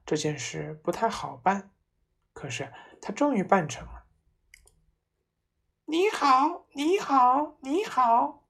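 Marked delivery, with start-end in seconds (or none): 5.9-8.4 high, squawking parrot greeting; much higher parrot voice. A narrator's reading. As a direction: chirp it like a bird. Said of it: "鹦鹉在壁炉里面对他叫".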